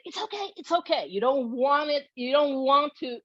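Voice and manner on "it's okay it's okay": quick, high, anxious repetition; anxious reassurance. Shown as a demonstration. Showing owners how not to soothe a dog, anxious. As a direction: reassure too fast in a high, worried voice. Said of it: "add your anxiety to the phrase".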